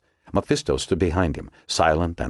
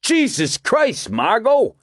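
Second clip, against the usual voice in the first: higher, sharp rebuke; angry snap; 4 dB louder, slower than usual. A narrator's reading. DeGosta scolds Margot for following them, an angry snap. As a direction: snap at her, slowly, annoyed. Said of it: "snapped angrily".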